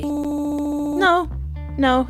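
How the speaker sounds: normal talking voice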